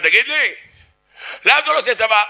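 high-pitched confrontational yell; accusing shout. Shown as a demonstration. Heckler yelling at a student standing straight, accusing. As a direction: shout the question down at him. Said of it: "והוא צועק עליך".

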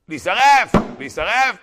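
loud high cry; outburst. Acted. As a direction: cry it out in full force. Preacher acts out a character's outburst. (צעקה רמה וגבוהה)

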